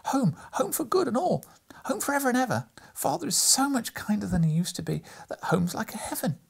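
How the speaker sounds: put-on high, bright, eager child's voice; gleeful little girl. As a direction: rattle it off like an excited child. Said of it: "full of glee".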